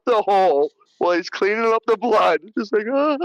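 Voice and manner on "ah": mock frightened little "ah"; scared gasp. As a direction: imitate someone nervously looking up. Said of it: "like, so scared".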